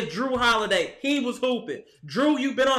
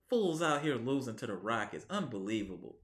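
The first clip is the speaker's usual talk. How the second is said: much softer, low, under the breath; disgusted mutter; 13 semitones lower, 12 dB quieter than usual. Real, not acted.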